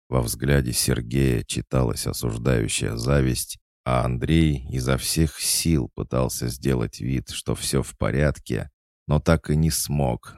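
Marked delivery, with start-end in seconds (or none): none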